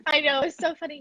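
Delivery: usual speaking voice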